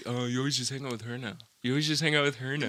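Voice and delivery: quick mocking whine; teasing friends. Friends teasing him about his girlfriend, quick mocking. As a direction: tease him for ditching them. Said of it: "they used to give me shit".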